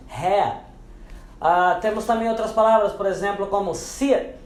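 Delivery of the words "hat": "hair" as "hat" with no audible T; silent final T. Shown as a demonstration. Teacher models the word for boné, "hair" with swallowed T. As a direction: stop "hair" on an open "heh" vowel, no R. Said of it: "mas não pronunciamos".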